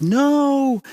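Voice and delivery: high, drawn-out "no"; retold cry of protest, stretched long and pitched high; pleading cry. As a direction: call it out as if being pulled away. Reaching out while sucked backwards.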